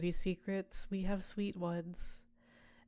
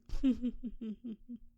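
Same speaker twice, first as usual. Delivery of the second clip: high seductive chuckle; amused laugh; 6 semitones higher than usual. Acted. Succubus amused by the staring mortal, flirty laugh.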